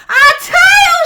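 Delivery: high, loud joyful cry; excited shout. A narrator's reading. Possums shouting as the tail appears. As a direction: shout it out in delight. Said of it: "shouted both possums at once".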